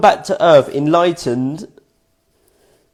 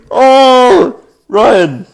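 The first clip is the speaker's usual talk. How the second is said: loud, high outburst mid-laugh; scolding yourself while cracking up; laughing groan of exasperation; helpless laughter; 7 semitones higher, 8 dB louder than usual. Real, not acted. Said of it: "I don't know why I'm laughing".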